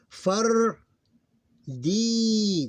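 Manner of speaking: "far" then "D", each loud, high and held; long sound. Shown as a demonstration. Teacher demonstrating the same long sound, loudly drawn out. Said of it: "the same thing".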